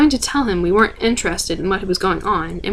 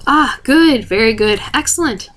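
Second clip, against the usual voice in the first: higher, approving doctor voice, bright praise; pleased doctor; 3 semitones higher, 3 dB louder than usual. Acted. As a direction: praise them like a smug scientist.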